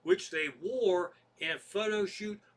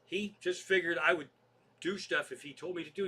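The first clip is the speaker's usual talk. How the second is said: ordinary talk, nothing marked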